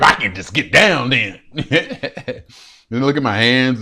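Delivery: normal talking voice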